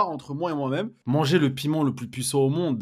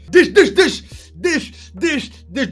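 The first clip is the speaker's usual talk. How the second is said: cris de brûlure; 10 semitones higher, 9 dB louder, much slower than usual. Real, not acted. Cris face au piment brûlant.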